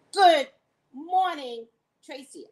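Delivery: "Good Morning Tracy" loud, high and drawn out; show-name intonation. Shown as a demonstration. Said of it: "I gotta get the right intonation".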